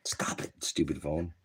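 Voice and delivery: annoyed whisper under the breath. Real, not acted. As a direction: hiss it quietly at the phone. Muttering at phone during stream.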